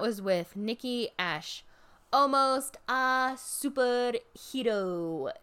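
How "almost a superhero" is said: slow, higher Scottish lilt on the catchphrase; a light Scottish accent, drawn out. Retold or imitated. Fan imitates Nikki Ash's catchphrase, slow Scottish accent. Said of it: "she has a really cute accent".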